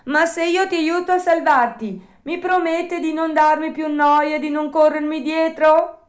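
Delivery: high, loud puppet voice setting terms; a wary puppet striking a deal; Pinocchio bargaining. A narrator's reading. Pinocchio bargaining with the drowning dog, high and cautious. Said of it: "voltandosi al cane gli disse".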